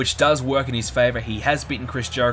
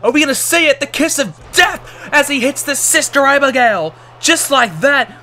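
excited, loud play-by-play shouting; wrestling commentator; 12 semitones higher, 9 dB louder than usual. Acted. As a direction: call the finish like a ringside announcer. Live match play-by-play.

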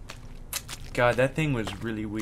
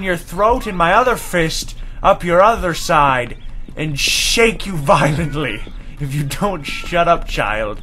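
loud, higher, emphatic threat; exasperated rant; 5 semitones higher, 9 dB louder, slower than usual. Real, not acted. Player threatening a noisy game baby, loud furious rant. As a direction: hit the words hard, building a ranting threat.

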